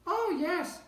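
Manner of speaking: very high piping squeak; high squeaky yes; piping tiny voice. A narrator's reading. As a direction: pipe it up like a tiny Who. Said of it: "piped the voice".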